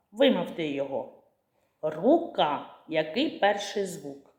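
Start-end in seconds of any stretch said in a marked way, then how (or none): none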